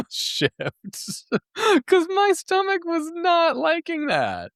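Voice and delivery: high-pitched giggle through the words; laughing. Real, not acted. Vlogger laughing about her gut troubles, high giggly voice. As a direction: laugh it out, embarrassed by the memory. Laughing at own embarrassing story.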